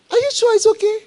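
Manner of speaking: a high, loud mock doubter; doubting voice. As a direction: jump way up and doubt it.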